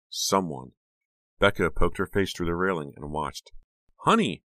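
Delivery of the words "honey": high startled cry of the name; shocked gasp of a name. A narrator's reading. Girl spots friend in her bedroom, startled cry. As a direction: jolt of shock at spotting her. Sees intruder in her room.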